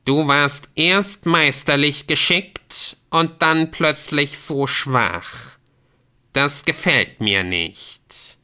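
slow, drawn-out reproach, starting high; displeased game messenger. Acted. Messenger turns to Squamanto after battle, displeased. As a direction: reprove slowly and imperiously.

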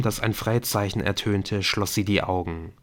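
plain reading voice

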